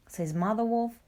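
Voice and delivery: ordinary delivery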